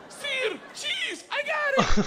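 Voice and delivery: very high, excited yelling; eager pupil. Acted. Student proud of his Russian to Igor, high excited yell.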